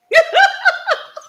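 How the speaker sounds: big shrieking laugh; loud high laugh. Real, not acted. Laughing at bidders' logic.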